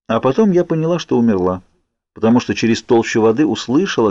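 usual speaking voice

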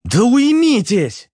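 high, drawn-out exasperated plea; pleading. A narrator's reading. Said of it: "Взмолился Руслан". (высокая, протяжная мольба)

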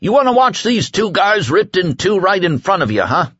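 frantic appeal. A narrator's reading. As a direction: hammer the crowd with questions. Whitney haranguing crowd.

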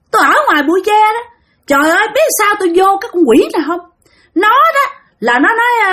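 high, eerie spirit voice; possessed spirit. Retold or imitated. Spirit tells the man where it lives, high and possessed. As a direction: talk as a spirit inside someone, pitched high. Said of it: "mới nói rằng".

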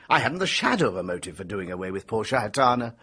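ordinary delivery

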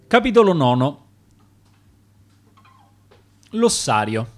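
ordinary delivery

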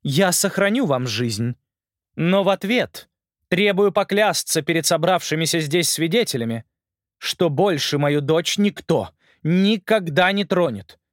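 a hint of a cold, commanding queen; stern queen voice.

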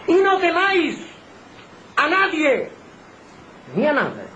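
high, ringing pulpit cry; high-pitched fervent command; impassioned exhortation. Real, not acted. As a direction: urge the flock with full force. Preacher exhorts the congregation.

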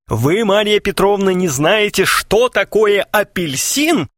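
high, outraged mock fury; fierce growl. A narrator's reading. Suitor confronts clueless Maria Petrovna, fiercely. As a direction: demand it in theatrical indignation. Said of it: "свирепо зарычал Клинков".

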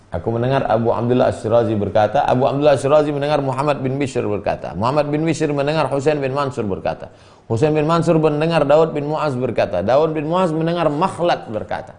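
a quick run through the chain of names; fast recitation. Shown as a demonstration. Preacher races through the chain of narrators, fast.

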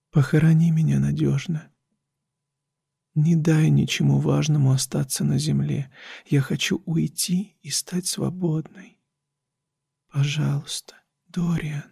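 soft hushed plea, fading to breath; pleading whisper. A narrator's reading. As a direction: beg quietly, close to his ear. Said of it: "приблизила губы к моему уху".

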